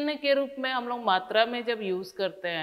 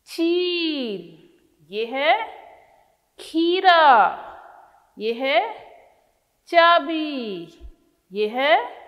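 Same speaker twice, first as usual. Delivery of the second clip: each picture name drawn out slowly, some louder; name the pictures slowly for small children; teacher naming pictures very slowly; 3 semitones higher, 4 dB louder, much slower than usual. Shown as a demonstration.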